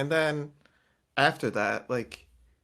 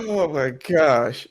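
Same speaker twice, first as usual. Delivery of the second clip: excited; 3 semitones higher, 11 dB louder, much slower than usual. Real, not acted. Delighted exclamation.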